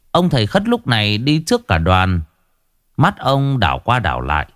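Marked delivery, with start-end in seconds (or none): none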